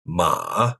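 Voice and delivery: MA dipping low, then rising a bit; dipping third tone. Shown as a demonstration. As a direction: sink low on "Ma", then lift. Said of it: "dips down low and then rises a bit".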